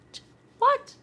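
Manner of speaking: mock disbelief, high squeal; amazed. Real, not acted. Vlogger marveling at flashlight stickers, squeaky surprise.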